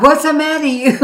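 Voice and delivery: put-on loud, high Italian-family accent with laughter; Italian-American accent. Preacher repeats what she heard all her life, laughing Italian accent. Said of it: "an Italian family".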